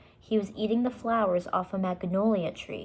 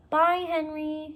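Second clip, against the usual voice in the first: fond goodbye; 9 semitones higher, 3 dB louder, slower than usual. Acted. Annie bidding pteranodon goodbye.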